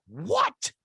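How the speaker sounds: high, rising retold disbelief; shocked. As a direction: act out a stunned reaction, pitch jumping up. Retelling own shocked reaction.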